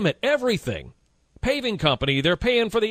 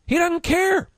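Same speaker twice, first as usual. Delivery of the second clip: high indignant; 10 semitones higher than usual. Real, not acted. Host ranting about Romney.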